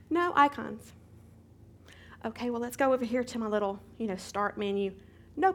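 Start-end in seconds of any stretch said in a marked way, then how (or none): 0.1-0.9 higher, louder disbelief; exclaimed in shock; louder shocked repeat. Retold or imitated. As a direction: say it again, louder and dismayed.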